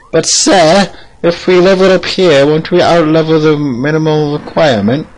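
high, objecting put-on voice; questioning pupil character. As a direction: query the master in a high voice. Plays questioning pupil to a master.

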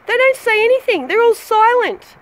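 fed-up rant at friends who never reply; angry rant. Real, not acted. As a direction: vent the frustration, voice climbing. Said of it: "You know why I'm angry?".

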